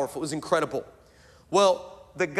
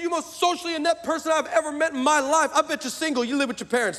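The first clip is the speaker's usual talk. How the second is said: high-pitched comic exasperation; exasperated retort; 9 semitones higher, faster than usual. Retold or imitated. Preacher telling off the socially inept stranger, high comic exasperation. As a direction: scold him in a raised, incredulous pitch.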